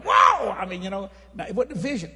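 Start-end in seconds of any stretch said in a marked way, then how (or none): 0.0-0.5 very high, very loud startled yell; full holler. Retold or imitated. Preacher showing how he literally hollered, loud high yell. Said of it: "I mean, I literally hollered".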